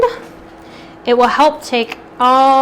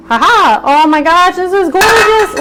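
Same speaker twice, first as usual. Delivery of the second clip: excited; 7 semitones higher, 7 dB louder than usual. Real, not acted. Reacting to the finished veggie medley.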